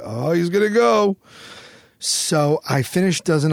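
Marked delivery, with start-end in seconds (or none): 0.0-1.2 higher, teasing crowd voice; mock onlooker. Onlookers at the oyster table tease him, high mock voice. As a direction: everyone watching him about to vomit, higher and teasing. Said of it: "everybody'd be like".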